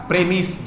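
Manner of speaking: प्रेमिस with short e and short i; pronunciation demo. Shown as a demonstration. Teacher modeling the noun pronunciation. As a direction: say प्रेमिस as prem-is, not pree-mize. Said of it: "तब इसका उच्चारण देखना प्रेमिस होगा".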